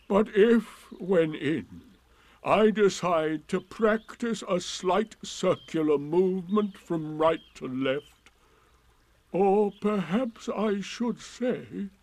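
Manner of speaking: slow, pointed dignity; pompous Eeyore. Acted. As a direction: explain yourself with heavy irony.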